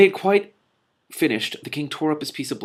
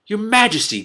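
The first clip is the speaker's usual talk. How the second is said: higher, louder, shocked "Your Majesty"; shocked gasp; 13 semitones higher, 9 dB louder than usual. Acted. Prime Minister gasping at the king's refusal, shocked. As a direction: gasp it out in alarm. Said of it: "gasped the Prime Minister".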